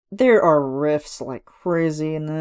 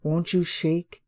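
villain's offer; much slower than usual. Retold or imitated. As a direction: draw the words out like a sly dealer. Imitating Facilier's deal line.

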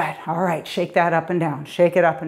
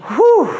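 loud, high "whew"; high-pitched energetic hoot; high exertion whoop; 12 semitones higher, 5 dB louder than usual. Real, not acted. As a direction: whoop through the effort of the workout. Whoop while exercising hard.

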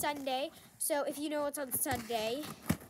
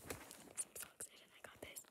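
whispered, much softer; 22 dB quieter than usual. Real, not acted. Vlogger whispering her excitement to viewers, soft whisper. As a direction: breathe it out in a secret whisper. Hushed after ASMR moment.

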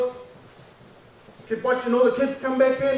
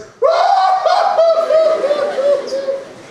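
high, loud mock sobbing; hammed-up loud wailing; 5 dB louder than usual. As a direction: bawl comically at full volume. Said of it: "I'm not talking quiet tears".

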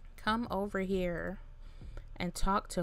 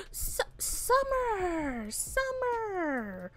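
high, slow, drawn-out cry of the name; shocked call; 10 semitones higher, much slower than usual. Real, not acted. Gamer crying out to her Sim mid-fight, shocked.

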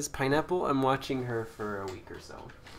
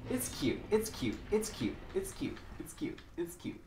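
higher, softening to a whisper; high cutesy voice; 5 semitones higher, 5 dB quieter than usual. Shown as a demonstration. Gamer coos over something cute, high cutesy voice fading to a whisper. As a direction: coo it in a tiny voice. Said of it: "repeats in different voices".

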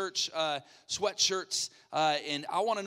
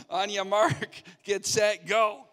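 loud, higher, drawn-out countdown; raised-voice race starter; game-start call; 4 semitones higher, 7 dB louder, slower than usual. Real, not acted. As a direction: shout the contestant off the line. Host starting the last round.

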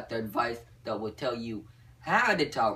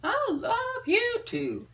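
put-on very high falsetto girl voice, slow; dreamy falsetto reply; swooning girl reply; slower than usual. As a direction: answer like a smitten schoolgirl. Girl answering his line.

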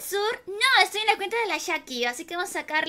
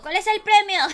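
louder, laughing re-ask; teasing, louder, laughing; laughing repeat; 3 semitones higher, 7 dB louder than usual. Real, not acted. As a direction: push the question again through a laugh.

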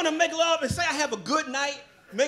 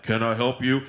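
low-voiced clerk; 12 semitones lower than usual. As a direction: sound like a big man asking politely.

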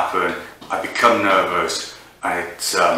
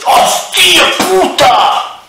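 high, loud, slow drawn-out yell; screeching involuntary curse; shouted swear; 11 dB louder, much slower than usual. Pretend. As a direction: stretch the curse out at full volume. Mock tic outbursts of swearing.

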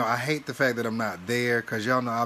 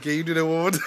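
laughing request; 2 semitones higher, faster than usual. Retold or imitated. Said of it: "I said".

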